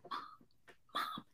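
hushed calling from the stairs; whispered mom. Retold or imitated. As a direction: daughter whispering for mom from the steps.

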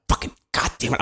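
soft, whispered cursing in frustration; mad. Retold or imitated. Walking back alone at night, mad, cursing under his breath. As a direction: seethe under your breath, re-enacting it. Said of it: "I'm so mad".

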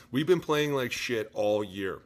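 ordinary delivery

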